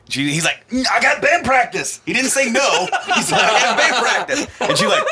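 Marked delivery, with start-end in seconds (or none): 0.7-2.0 high, loud imitation of a feeble excuse; mock protest. Joe giving her his excuse, loud high mock protest. Acts Joe's excuse a second time. 3.4-4.5 high, loud imitation of a feeble excuse; mock protest. Joe giving her his excuse, loud high mock protest. Repeats Joe's excuse amid laughter.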